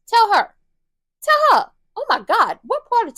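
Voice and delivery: losing patience, shouting at her to just tell; exasperated shout. Real, not acted. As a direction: Tell her! Tell her! bursts out of frustration. Exasperated at Nina not telling.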